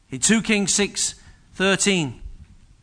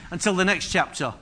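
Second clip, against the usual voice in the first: ordinary talk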